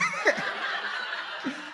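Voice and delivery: high, airy real laugh; burst of laughter. Real, not acted. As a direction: break into a high, breathless laugh. Laughs at own salad joke.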